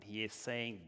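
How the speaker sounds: ordinary delivery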